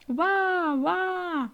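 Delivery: high, loud, drawn-out cooing; acted delight. Admirer greeting the newborn baby, high excited exclamation. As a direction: exclaim over the new baby, pitched right up.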